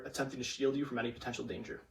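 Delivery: normal talking voice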